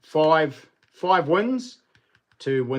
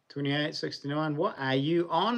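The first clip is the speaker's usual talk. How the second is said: ordinary talk, nothing marked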